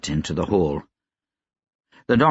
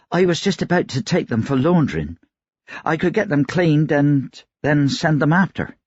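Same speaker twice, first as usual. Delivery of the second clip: higher-pitched laundress, offering help; laundress Nyla; 7 semitones higher than usual. Acted. Laundress offers to clean the boy's clothes, higher-pitched character voice.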